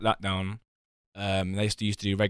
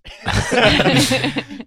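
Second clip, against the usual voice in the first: big loud cackle; loud burst of laughter; 10 dB louder than usual. Real, not acted. Friend cracks up at joke.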